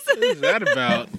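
squeaky high mock shock; mock surprise. Real, not acted. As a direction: squeal it in fake disbelief.

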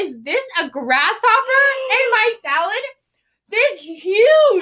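high, loud, panicked voice cracking; horrified shriek. Retold or imitated. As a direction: shriek it in disgust. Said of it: "She said".

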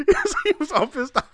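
ordinary talk

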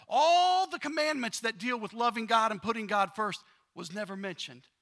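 loud, rising preacher's build; preaching at full force. Acted. Preacher pressing the congregation on loving God, loud preaching. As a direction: drive the point home to the pews.

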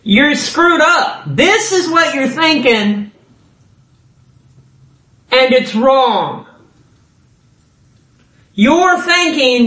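pointed preacher voicing a reproach; prophet's rebuke. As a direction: call them out like an exasperated prophet. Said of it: "he's like".

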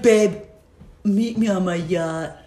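lightly voiced smug rich guy. Rich man voiced in story.

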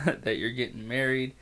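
ordinary talk, nothing marked

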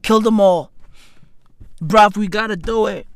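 ordinary talk, nothing marked

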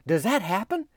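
high-pitched, worried question; imitated scare. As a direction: mimic a friend suddenly alarmed. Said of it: "then she got scared".

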